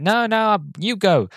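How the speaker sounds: high mock yelp of a scared pilot; hammed-up coward passing the buck; cowardly refusal. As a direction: push it onto someone else, panicky. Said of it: "they're like".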